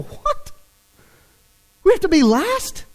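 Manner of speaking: high, startled "What?"; shocked disciples. Retold or imitated. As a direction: react in disbelief. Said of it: "sitting there going".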